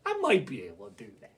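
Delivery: very low, soft, quick answer; Brer Rabbit. Acted. Rabbit agreeing to trick the wolves, low and sly. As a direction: drop right down, keep it quiet. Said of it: "And I went".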